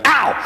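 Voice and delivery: a sharp, high yelp of pain; demo yelp of pain. Shown as a demonstration. Trainer showing the puppy how a bite hurts, high yelp. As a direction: yelp high like it really hurt. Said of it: "we wait till he bites hard and we go".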